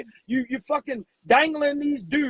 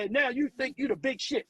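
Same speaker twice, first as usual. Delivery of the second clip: ordinary talk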